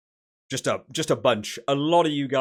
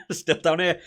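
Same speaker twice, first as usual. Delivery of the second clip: laughing; 4 semitones higher, 5 dB louder than usual. Real, not acted. Laughs about Wales crashing.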